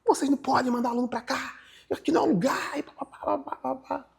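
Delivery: high-pitched imitation of an angry scolding; indignant high mimicry, trailing into filler; mock telling-off. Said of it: "o cara esculachou as pessoas".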